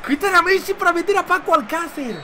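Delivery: high-pitched astonished outcry; incredulous. Real, not acted. Player sees Messi taken off for Alcácer, incredulous.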